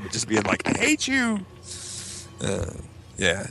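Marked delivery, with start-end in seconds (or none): 0.7-1.4 high, strained scream held back in volume; mock scream. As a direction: imitate screaming at dad through a wall. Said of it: "screaming through the wall".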